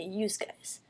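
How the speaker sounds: soft Northern-accented "Yous guys"; quiet mock-Northern "Yous guys". Shown as a demonstration. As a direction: imitate a Northerner, "yous" ending in an s. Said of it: "they say it in their northern accents".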